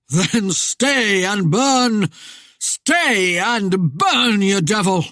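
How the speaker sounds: slow, loud, drawn-out curse; menacing spectre. A narrator's reading. Spectre threatens after horrendous laugh.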